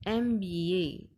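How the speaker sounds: एमबीए said slowly, letter by letter; slow demo of एमबीए. Shown as a demonstration. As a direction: spell out एमबीए slowly, starting with em. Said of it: "एमबीए में एम". (एमबीए धीरे-धीरे, एम की आवाज़ साफ़)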